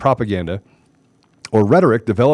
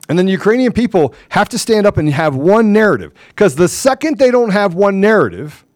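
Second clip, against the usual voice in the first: higher and louder, hammering each point; heated rant; 8 semitones higher, 4 dB louder than usual. Real, not acted. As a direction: build to a fever-pitch rant. Building rant about Ukraine leadership.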